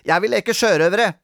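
put-on high, loud demanding child; bratty, insistent cry; naughty boy. A narrator's reading. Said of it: "sa rampete Robin".

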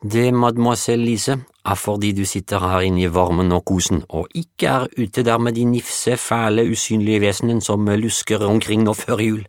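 put-on high teasing French-accented cook. Juliette teasing Lise about creatures, in a French accent. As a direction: spook the girl gently, French lilt.

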